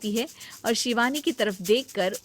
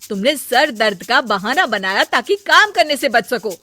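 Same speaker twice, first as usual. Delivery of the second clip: louder, mocking telling-off; sarcastic scolding; 4 semitones higher, 9 dB louder than usual. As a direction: scold her for faking. Scolding the lying sister-in-law. (जेठानी देवरानी को ताना मारकर डाँटती है)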